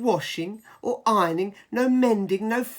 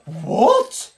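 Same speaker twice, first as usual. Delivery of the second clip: loud rising outraged what; mock startled emperor; shocked what; 7 dB louder than usual. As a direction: react as if insulted. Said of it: "said the emperor".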